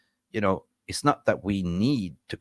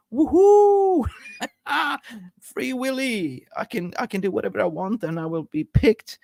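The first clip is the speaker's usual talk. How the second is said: gleeful Harry Maguire; 13 semitones higher than usual. Retold or imitated. Fan mocking a defender who is celebrating his freedom, giddy high squeal. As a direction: squeal it like a footballer just set free. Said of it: "Harry Maguire would be like".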